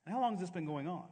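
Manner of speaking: mock clinical inquiry, rattled off lightly; unruffled doctor. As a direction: imitate a doctor asking over his glasses. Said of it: "He looks over his glasses and he says".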